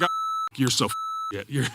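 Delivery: laughing through a mock put-down; laughing mock scorn. Teammate mocking his answer while laughing. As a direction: quote him teasing through giggles. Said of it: "And Mark's like".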